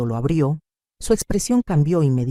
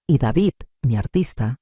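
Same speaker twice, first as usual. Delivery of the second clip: plain reading voice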